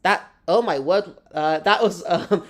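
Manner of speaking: normal talking voice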